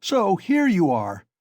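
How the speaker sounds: slow, high, loud angry shout; shouting at the top of his voice. A narrator's reading. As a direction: bellow it at him in fury. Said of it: "he shouted at the top of his voice".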